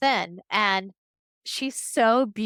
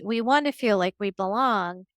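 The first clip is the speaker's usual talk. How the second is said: ordinary talk, nothing marked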